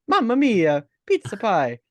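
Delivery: mock Italian chef cheer; jolly italian pizza chef. Mock Italian mobster bit.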